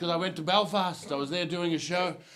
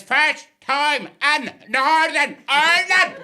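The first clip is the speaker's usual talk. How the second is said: put-on loud, word-by-word Belfast accent, high-pitched; exasperated Belfast cabbie, each word separate; slow, emphatic Northern Irish; 6 semitones higher, 7 dB louder, slower than usual. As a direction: spell it out slowly in harsh Northern Irish. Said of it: "One more time".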